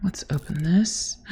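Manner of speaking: hushed whisper to self. Real, not acted. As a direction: whisper it like sneaking. Whispering while looting.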